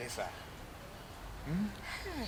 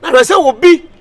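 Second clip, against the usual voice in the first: loud, high ranting; shouting; 9 semitones higher, 18 dB louder than usual. Acted. Hype man ranting loudly to the crowd. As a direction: rant loudly.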